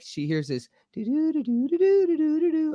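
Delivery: high, wavering doo-doo sounds that slide up and down; imitated warbling voice. Retelling the ghost recording, imitates the warbling sound. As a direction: mimic a voice going high and low on the recording. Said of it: "her voice went, you know, high and low".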